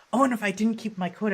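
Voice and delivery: high, loud, quick outburst; excited realisation. Real, not acted. Player realising the coat mattered, excited. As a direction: blurt it out fast and loud.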